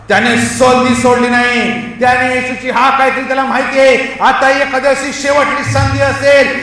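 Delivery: full-force sermon cry; urgent preaching. Acted. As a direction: plead as if it is the last chance. Preaching as the blind man, urgent.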